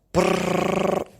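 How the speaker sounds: high, very loud rattling ring; alarm clock ring. Acted. Narrator voicing the alarm clock, loud ringing. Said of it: "went the alarm clock".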